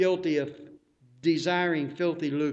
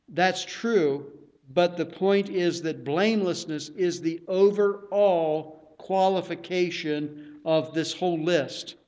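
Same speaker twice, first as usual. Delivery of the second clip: usual speaking voice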